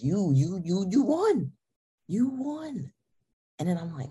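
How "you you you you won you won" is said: stammered awe climbing higher; amazed excitement. Retold or imitated. Girlfriend through the roof at his win, amazed delight. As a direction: build from disbelief to high-pitched delight.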